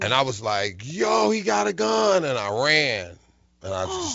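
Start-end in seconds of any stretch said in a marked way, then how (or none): 0.8-2.2 high alarmed cry; mock panic. Warning his friends about the gun, high and panicked. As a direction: sound the alarm in a fright.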